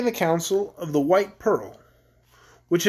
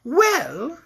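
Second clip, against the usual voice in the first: higher, rising, amused musing; amused queen; 9 semitones higher than usual. A narrator's reading. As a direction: let the victim dangle. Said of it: "shaking with laughter".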